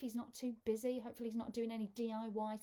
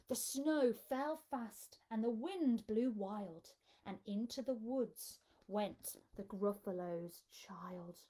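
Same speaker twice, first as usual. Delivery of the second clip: ordinary delivery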